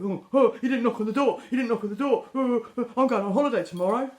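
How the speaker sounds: mock the indignant customer's whine; hammed-up outraged customer, fast; whiny mock complaint. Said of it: "attack back with".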